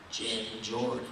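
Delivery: low, quiet, loaded calling of a name; a hushed, knowing call from behind; ominous name call. Retold or imitated. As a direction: say the name like a veiled threat. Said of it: "just the way they said it".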